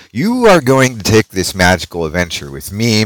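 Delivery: usual speaking voice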